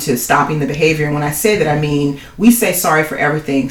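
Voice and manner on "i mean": ordinary talk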